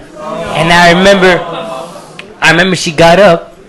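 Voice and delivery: ordinary talk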